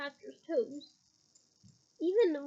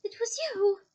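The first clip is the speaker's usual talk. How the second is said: louder, higher shocked gasp; 6 semitones higher, 8 dB louder, slower than usual. Acted. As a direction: gasp it in disbelief. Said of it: "gasped Harry".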